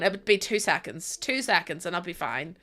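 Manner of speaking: low quiet joking plot; muttered scheming. Retold or imitated. Eyeing the pillow, quietly joking about husband. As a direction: murmur it like a dark joke. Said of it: "and I'm going".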